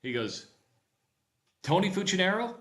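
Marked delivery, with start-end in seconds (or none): 1.6-2.5 loud, high-pitched incredulous question; astonished recognition. Retold or imitated. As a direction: blurt the name as a disbelieving question. Stranger recognises his boss's name.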